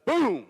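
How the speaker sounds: sudden booming shout; loud, high-pitched explosion imitation; imitated blast. Driver imitating the roadside bomb going off, explosive shout.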